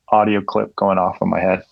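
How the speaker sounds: normal talking voice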